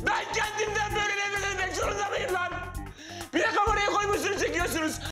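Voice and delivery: high-pitched, anguished shouted rant; full-blown escalation. Acted. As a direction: wail in outrage like a man betrayed by everyone. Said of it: "yüksel [name]".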